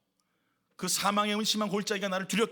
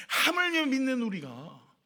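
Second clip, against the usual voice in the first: usual speaking voice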